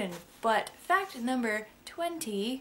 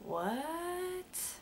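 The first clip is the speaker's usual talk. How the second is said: imitating shocked friends; 7 semitones higher, 6 dB quieter than usual. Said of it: "they're like".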